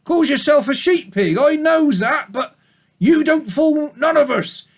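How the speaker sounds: very high, loud scolding sheep voice; old ewe driving off the supposed wolf; suspicious old ewe. Acted. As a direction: accuse him like a sour old ewe.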